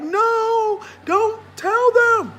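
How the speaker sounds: high, loud mock wail; hammed-up drawn-out shout of protest; protesting viewers. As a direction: cry it out slow and high, imitating horrified viewers. Imagined viewers begging her to stop.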